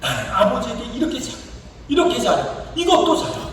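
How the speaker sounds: high-pitched acted bragging, climbing with each item; imitating a self-righteous son; self-righteous boasting. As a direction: tick off virtues in a rising mock boast. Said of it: "자기의 의로움을 얘기합니다".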